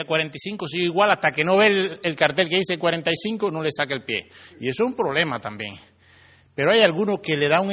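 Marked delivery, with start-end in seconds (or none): none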